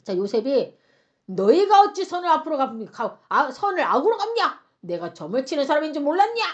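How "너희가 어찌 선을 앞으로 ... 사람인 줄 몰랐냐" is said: raised, accusing voice; acted angry reprimand, louder at the start; thundering rebuke. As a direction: scold them as the offended master. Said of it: "막 호통을 치는 거죠".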